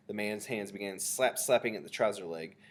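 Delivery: plain reading voice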